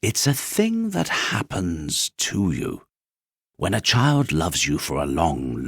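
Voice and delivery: put-on slow, tender old horse; unhurried wise old horse; wise Skin Horse. A narrator's reading. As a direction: explain gently like a kind grandfather.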